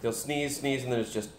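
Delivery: normal talking voice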